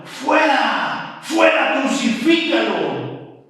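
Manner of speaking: high, loud furious crowd yell, last word drawn out slowly; crowd shouting. Retold or imitated. The crowd shouting at Pilate, a loud furious yell. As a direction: scream for him to be crucified. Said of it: "Pero ellos gritaron".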